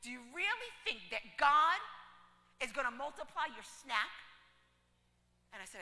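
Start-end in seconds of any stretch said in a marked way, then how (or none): 0.0-4.1 loud, incredulous anger; mad. Retold or imitated. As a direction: scoff at him loudly. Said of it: "she's so mad".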